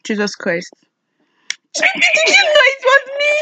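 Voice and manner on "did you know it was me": you know it was me, squeaked very high; astonished. Real, not acted. Prankster caught by her friend, shocked high squeak. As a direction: caught out and disbelieving, pitch leaping up.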